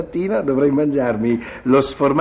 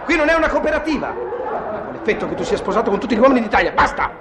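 exasperated husband ranting, much higher; furious outburst; 11 semitones higher than usual. Acted. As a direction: blow up at her. Husband erupts at wife.

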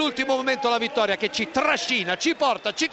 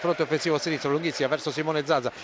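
live play-by-play; 8 semitones lower, faster than usual. Real, not acted.

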